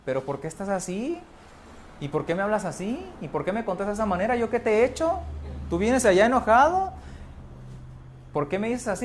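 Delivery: high, rising, complaining questions; wounded partner nagging an angry spouse; whiny partner. Retold or imitated.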